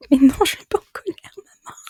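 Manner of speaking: whispered but rising in protest. Retold or imitated. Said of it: "tu hausses le ton".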